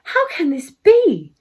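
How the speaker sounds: storybook toad, amazed; astounded. A narrator's reading. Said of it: "Monty was astounded and said".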